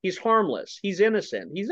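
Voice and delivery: ordinary talk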